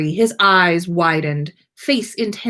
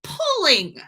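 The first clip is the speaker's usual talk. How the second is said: exasperated shout; 11 semitones higher than usual. A narrator's reading. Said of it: "Harry shouted back, exasperated".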